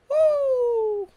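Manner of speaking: loud, very high whoop of triumph; excited cheer. Real, not acted. Gamer celebrating a kill, loud excited whoop.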